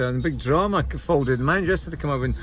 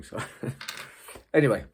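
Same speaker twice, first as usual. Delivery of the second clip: normal talking voice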